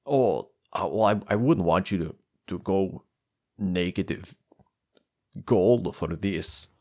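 lightly put-on Russian-dwarf voice; hesitant dwarf with a hint of an accent. Dwarf haggles with tutor over payment, lightly voiced.

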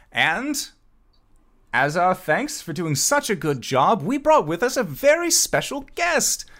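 high, theatrical presenter voice; showman camp manager, building suspense. Acted. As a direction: build up to the big reveal, pitch climbing. Camp manager announces guest.

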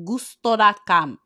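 gusto dot com said with a hammed-up Italian accent, rolling and bouncy; mock Italian flair on gusto dot com. Vlogger jokingly says the brand name in an Italian accent. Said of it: "I didn't need to do the accent".

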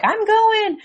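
high-pitched, chirpy announcement; mock-excited mom voice; imitating eager mom. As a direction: pitch it way up, like Mom declaring herself in. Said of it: "surprised when Mom's like".

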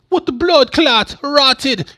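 shouted high Jamaican kangaroo outburst; furious cartoon kangaroo god in a Jamaican accent. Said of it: "it talks in a Jamaican accent".

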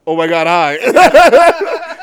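slow, high, loud giddy gasp with a laugh; starstruck squeal. Retold or imitated. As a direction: gush like a fan meeting his hero. Starstruck meeting the wrestler.

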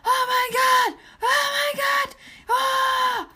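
high, loud drawn-out screams; over-the-top squealing hype; ecstatic screaming. Acted. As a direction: scream in disbelief at a huge win. Screams at the number reached.

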